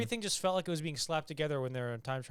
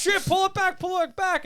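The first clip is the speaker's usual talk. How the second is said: much higher and louder panicked cry; mock panic; 9 dB louder than usual. Villains in the plane yelling in panic at the portal. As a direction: yelp it like crooks losing control of the plane.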